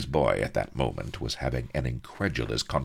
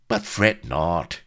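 smooth, slow and higher; smooth reassurance; 6 semitones higher, 6 dB louder, slower than usual. A narrator's reading. Vladimir soothing aching Master Pepys, smoothly. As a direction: draw it out, smooth and slick. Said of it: "continued Vladimir smoothly".

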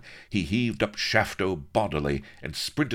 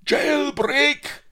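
very high, loud alarm bawl; 8 dB louder than usual. Acted. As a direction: yell it out as the alarm goes up. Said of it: "bawled the guards".

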